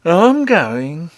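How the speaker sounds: dozy, reluctant reply; sleepy. A narrator's reading. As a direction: answer as if half asleep. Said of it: "said Harelip sleepily".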